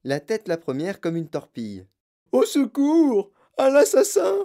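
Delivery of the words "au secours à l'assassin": very high, loud cries for help; frantic mother shrieking; hysterical yell. A narrator's reading. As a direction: scream for the police in panic. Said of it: "hurla madame Gloop".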